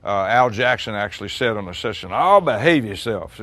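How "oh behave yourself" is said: slow, louder, drawn-out mock scolding; imitating a bandmate's joking reprimand; teasing scold. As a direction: drawl it out like a playful telling-off. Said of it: "you know, like that".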